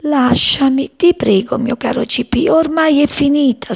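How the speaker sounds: high-pitched plaintive dying plea; sighing plea. A narrator's reading. Dying daisy begs sparrow to leave her, sighing plea. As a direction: plead high, sighing out each phrase. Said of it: "sospirò".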